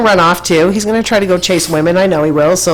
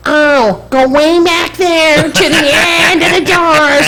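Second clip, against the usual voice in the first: imitating ticket lady; 10 semitones higher, slower than usual.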